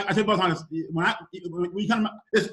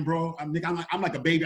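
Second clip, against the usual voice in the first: ordinary talk, nothing marked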